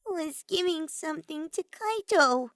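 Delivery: put-on high tense girl's voice; stiffly explaining, on edge. A narrator's reading. Himiko explains herself to the group, high and stiff. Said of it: "Himiko explained stiffly".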